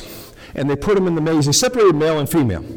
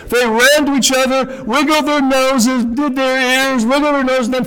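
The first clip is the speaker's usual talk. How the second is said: excited mice; 8 semitones higher, 3 dB louder than usual. Acted. Female mice greeting each other.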